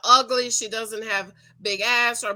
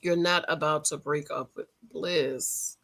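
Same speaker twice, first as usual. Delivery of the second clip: low disbelief; 7 semitones lower, 4 dB quieter than usual. Retold or imitated. Vlogger reacting to a reality show breakup, low stunned disbelief. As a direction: drop the voice low in shock. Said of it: "And I was like".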